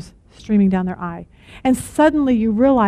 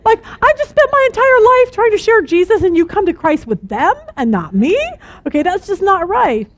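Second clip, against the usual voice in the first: indignant disbelief; 8 semitones higher than usual. Retold or imitated. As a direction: play it hurt and baffled, half joking. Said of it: "And Bill's like".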